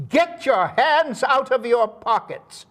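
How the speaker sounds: high-pitched imitated reprimand; hammed-up stern command from behind; mock scolding. Director-actress scolds him from behind onstage.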